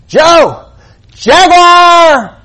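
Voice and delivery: high, loud panicked yell; panicked shout. Acted. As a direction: shout the warning in alarm. Joke, hiker yells warning.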